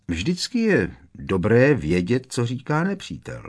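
usual speaking voice

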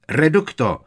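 loud, high battle cry; spell shouted. A narrator's reading. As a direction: hurl the spell out. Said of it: "Zahřmělo za ním". (hlasitě vykřikne zaklínadlo)

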